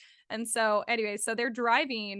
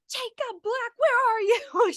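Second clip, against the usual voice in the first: high-pitched angry yell, louder at the end; imitated screaming; 12 semitones higher, 6 dB louder, slower than usual. Reteller as furious girl storming out of the car, screaming. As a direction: scream it out like calling someone to account.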